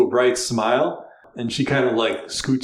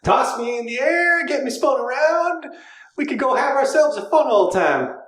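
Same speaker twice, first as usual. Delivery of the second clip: put-on flirt hard with the cat in a Southern accent; high, bawdy Southern flirt; flirty Southern accent; 11 semitones higher than usual. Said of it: "trying to find an accent for her".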